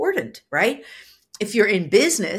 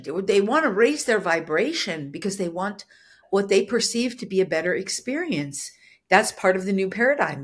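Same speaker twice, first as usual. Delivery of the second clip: normal talking voice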